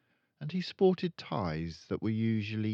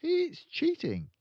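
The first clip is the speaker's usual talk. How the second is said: much higher, slow reproach; indignant girl. Acted. Matilda scolding her father, indignant child voice. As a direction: scold him gently. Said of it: "Matilda said".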